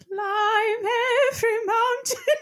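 put-on high warbling old lady singing. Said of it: "do my best old lady voice".

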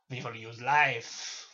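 slow, emphatic French villain; French accent. Acted. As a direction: announce it grandly with a French accent. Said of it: "sorry, it's 'cause he's French".

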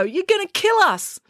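high-pitched alarmed protest; high, urgent objection; crying out. A narrator's reading. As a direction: cry it out in panic. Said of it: "cried Joe".

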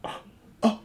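halting, breathy retold shock; acting out stunned disbelief; mock shock. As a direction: gasp it in small, stunned pieces. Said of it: "and I was like".

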